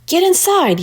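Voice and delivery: high, urgent call to get inside; yelled. A narrator's reading. Sarah yells at Annie as the storm nears, high and urgent. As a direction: shout the warning high and sharp. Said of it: "yelled Sarah".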